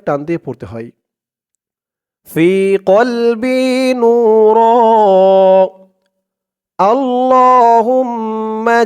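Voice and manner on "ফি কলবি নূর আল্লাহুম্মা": slow, drawn-out Quranic recitation. Shown as a demonstration. Teacher intoning the opening to viewers, slow recitation. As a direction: intone each word slowly and devoutly.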